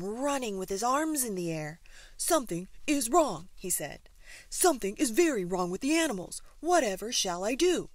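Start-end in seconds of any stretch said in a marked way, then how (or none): 2.2-3.5 high, loud alarm; alarmed zookeeper. A narrator's reading. Keeper running in to the animals, alarmed. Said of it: "jumped up and down with alarm". 4.5-7.9 loud, fretful, higher cry; alarmed zookeeper. A narrator's reading. Keeper at a loss over the animals, loud alarm. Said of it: "jumped up and down with alarm".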